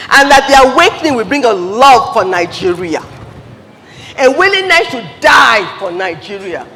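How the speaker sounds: loud, fervent declaration; rally them with full voice; rallying shout. Real, not acted. Speaker rallying the crowd to love Nigeria, loud.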